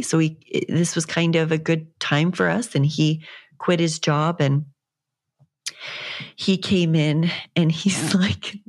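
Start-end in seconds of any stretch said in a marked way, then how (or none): none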